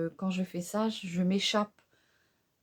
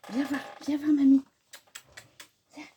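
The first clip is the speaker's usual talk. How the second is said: a lighter, somewhat higher pet-coaxing voice; 6 semitones higher than usual. Real, not acted. Grandma calling the cat again, mildly cooing. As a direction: call the cat gently, half cooing.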